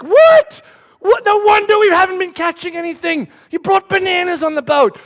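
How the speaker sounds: high, loud shout of disbelief; outraged fishermen. Acted. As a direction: play indignant fishermen yelling at a crewmate. Acts outraged fishermen reacting.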